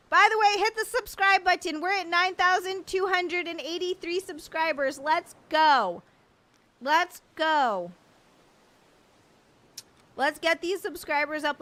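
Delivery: loud, high rallying call; rally the crowd at full voice; hype shout. Real, not acted. Streamer pushing viewers to subscribe, loud and hyped.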